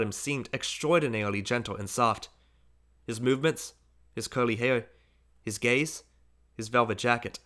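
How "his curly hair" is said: ordinary delivery, nothing marked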